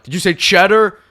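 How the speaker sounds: a high, loud clueless clerk; mock clerk. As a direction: play the confused clerk asking back. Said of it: "And then they're like".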